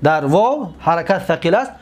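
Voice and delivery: normal talking voice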